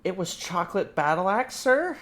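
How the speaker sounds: lightly voiced fussy customer, rising; mock polite customer. Player corrects the guild clerk, lightly voiced prim tone.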